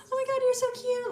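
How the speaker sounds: mock bubbly friendliness, pitched far up; bubbly gushing. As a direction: squeal it way up high, gushing. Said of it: "very bubbly around them".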